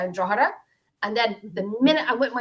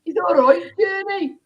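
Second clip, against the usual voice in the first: sing-song mock Birmingham voice; attempted Birmingham accent; 3 semitones higher, 4 dB louder, slower than usual. Shown as a demonstration. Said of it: "can't even do the Birmingham accent".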